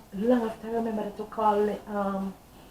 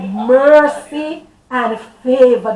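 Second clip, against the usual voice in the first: louder, slow and drawn out; loud slow proclamation; 3 semitones higher, 13 dB louder, much slower than usual. Retold or imitated. Testifier voicing God's words, slow and loud. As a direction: proclaim each word like a decree.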